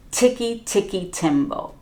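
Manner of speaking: plain reading voice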